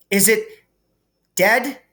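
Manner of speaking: high, anxious, breathless ask; a scared girl checking if it's dead; frightened whisper-high question. A narrator's reading. Hermione fearfully asks the boys about the fallen troll, frightened.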